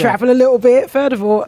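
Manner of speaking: loud, high-pitched telling-off; indignant rebuttal. Real, not acted. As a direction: scold the critics at full volume. Mock-indignant rebuttal of accent critics.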